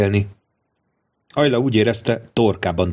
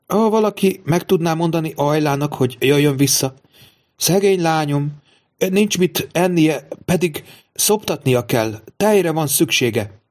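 mother's lament; 7 semitones higher than usual. Retold or imitated. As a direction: draw out the worried mother's plea. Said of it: "Folyton ezt mondogatta".

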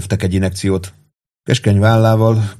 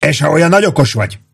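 higher, quick, challenging yell; 7 semitones higher, faster than usual. A narrator's reading. Pete challenging Warden as a know-all, yelling. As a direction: hurl the challenge in a raised voice. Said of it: "üvöltötte Pitt".

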